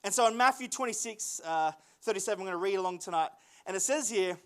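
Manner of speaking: ordinary delivery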